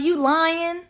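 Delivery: high doubting "you lying?"; incredulous skeptic. Retold or imitated. Skeptic's reply, quoted.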